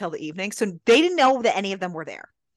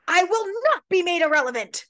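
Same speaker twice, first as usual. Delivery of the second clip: high, quick hammed-up outburst; retold tantrum in a high voice; mock tantrum; 12 semitones higher, 4 dB louder, faster than usual. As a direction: play an indignant tantrum, pitched way up. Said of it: "she's basically having a tantrum".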